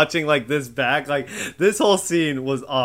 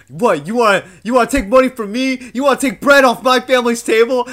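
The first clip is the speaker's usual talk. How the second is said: raised, louder imitation of a ranting man; mock angry rant; 9 semitones higher, 8 dB louder than usual. Friend mimics CT yelling at Wes, higher and louder, in a mock rage. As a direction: bark it out like a furious rival.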